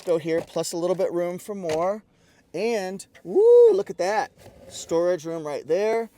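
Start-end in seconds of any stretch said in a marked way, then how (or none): 3.2-4.3 high, loud woo of delight; a real burst of delight at the find; excited woo. Real, not acted. Reviewer opening a hidden storage bin, excited woo.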